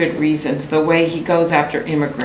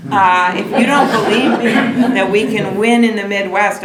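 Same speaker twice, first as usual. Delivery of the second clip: laughing; 5 semitones higher, 4 dB louder than usual. Real, not acted. Rally applause line, laughing.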